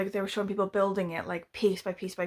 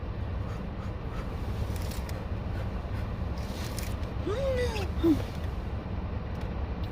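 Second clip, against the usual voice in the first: frightened gasp; 13 semitones higher than usual. Real, not acted. Spider panic.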